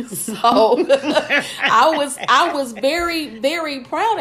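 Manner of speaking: high, loud words through laughter; laughing. Real, not acted. Proud mother admitting she isn't musical, laughing. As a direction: laugh your way into the words, slowly at first.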